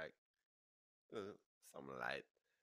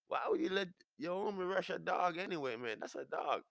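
high, loud, indignant questioning; hammed-up outraged pleading; incredulous protest; 13 semitones higher, 6 dB louder than usual. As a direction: scold him in pitched-up disbelief. Acted incredulous scolding.

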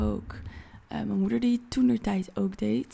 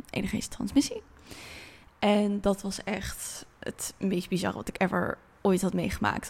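ordinary talk

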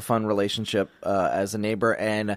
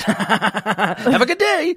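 laughing; 12 semitones higher, 4 dB louder, much slower than usual. Retold or imitated. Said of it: "always grinding his teeth".

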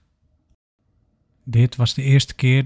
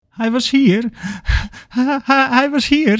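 very high, stammering panic; high panicked voice tripping over the first word; 11 semitones higher, slower than usual. A narrator's reading. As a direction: blurt it out in acted panic. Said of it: "zegt Charles in paniek".

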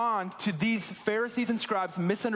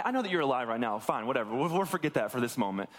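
lower, tossed-off aside; dismissive son; 7 semitones lower than usual. Acted. Younger son waving off that dad is still alive, dismissive lowered aside. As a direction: drop the pitch and brush the objection away. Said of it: "This younger son goes to his dad".

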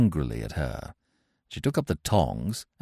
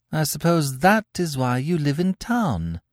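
sweetly; 10 semitones higher than usual. Acted. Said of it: "said Cecily sweetly".

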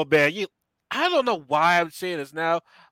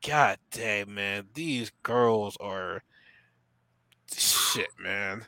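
low, slow, soft marvelling; retold awe; 7 semitones lower, 7 dB quieter, much slower than usual. Man shown photos of Russian girls, low slow awe. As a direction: drop low and draw it out, impressed.

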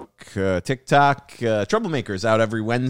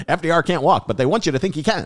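put-on high, louder old-radio announcer; hammed-up old radio voice; mock radio bulletin; 7 semitones higher, 4 dB louder than usual. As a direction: play along with the vintage broadcast.

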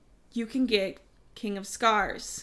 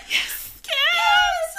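much higher, louder cheering yell; excited shout; 6 dB louder than usual. Retold or imitated. Retelling to her co-host how she cheered for Kaz, excited shout. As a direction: cheer it out loud as if the character can hear.

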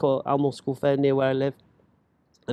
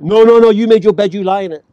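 loud, higher, cutting rejection from the dad; dad's harsh refusal; 4 semitones higher, 10 dB louder than usual. Retold or imitated. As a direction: bark it back like a father who won't budge. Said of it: "his response was like".

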